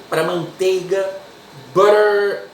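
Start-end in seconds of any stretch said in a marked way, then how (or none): 1.7-2.5 American English. Shown as a demonstration. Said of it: "inglês americano".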